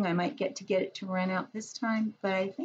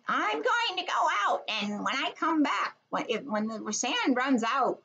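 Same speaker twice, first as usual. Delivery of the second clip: high, lightly voiced witch; 7 semitones higher than usual. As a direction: threaten like the Wizard of Oz witch. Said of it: "she has one of these and she says".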